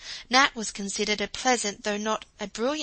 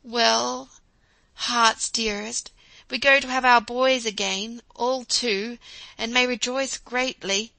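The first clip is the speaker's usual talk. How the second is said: put-on a touch of a fond old German professor, louder; lightly voiced beaming professor; 3 semitones higher, 7 dB louder, slower than usual. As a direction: warmly hail your wife with good news. Professor greets wife with joy.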